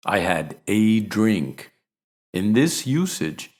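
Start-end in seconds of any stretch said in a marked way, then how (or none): 0.1-1.7 "a" said as long "ay" and stressed, slow. Shown as a demonstration. Husband assuring his wife, stressing "a" slowly. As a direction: lean on "a" in "I had a drink". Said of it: "you stress both the indefinite article".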